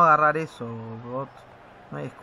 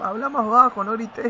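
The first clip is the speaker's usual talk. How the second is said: higher-pitched imitation of others complaining; retold telling-off; 6 semitones higher than usual. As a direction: quote their shushing in a raised pitch. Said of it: "también le decían". (regaño imitado, en tono más agudo)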